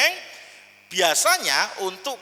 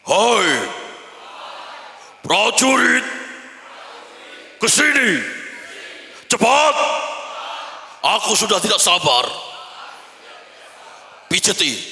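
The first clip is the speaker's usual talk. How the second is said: big voice; 5 semitones higher, 5 dB louder than usual. Shown as a demonstration. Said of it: "tirukan setelah saya".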